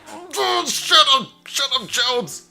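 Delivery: lightly voiced petulant grumble; annoyed mock voice. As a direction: imitate a sulking host. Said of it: "He's sitting there going".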